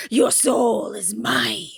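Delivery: very slow, menacing villain line; menacing villain impression. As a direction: drag each word out like a sinister sorcerer. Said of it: "Wait, I can do this".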